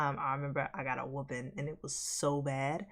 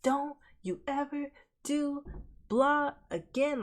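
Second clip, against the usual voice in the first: high, slow scolding mom; 10 semitones higher, 4 dB louder, slower than usual. Retold or imitated. Mom warning her kid mid-whooping, slow stern scold. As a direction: spell it out, scolding. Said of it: "she was like".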